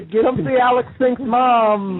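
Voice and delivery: high, slow barker call; lurid sideshow teasing. Retold or imitated. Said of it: "she'd be out like".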